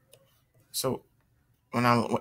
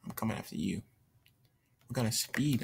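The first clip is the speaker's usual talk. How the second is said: ordinary delivery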